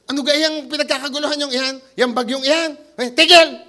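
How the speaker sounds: bagong gising na Batangueño, sumisigaw ng tigil sa dulo; Batangas accent. Retold or imitated. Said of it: "sa atin sa Batangas". (punto Batangas, galit na bagong gising)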